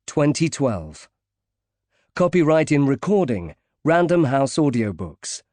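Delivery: formal closing credits announcement; audiobook credits read. Acted. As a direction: announce the publisher and copyright crisply.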